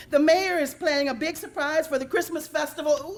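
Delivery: high, loud cracking confession; panicked blurt. Acted. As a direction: blurt it out as he breaks. Goose cracks under pressure.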